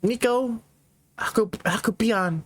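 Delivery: halting stammer with hesitant pauses; lightly acted shy boy; shy, nervous. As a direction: stumble timidly through introducing yourself. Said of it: "He's really shy by the looks of it".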